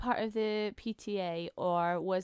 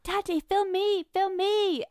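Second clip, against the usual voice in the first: put-on little girl voice; 9 semitones higher, 5 dB louder, slower than usual. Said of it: "And then I'd be like".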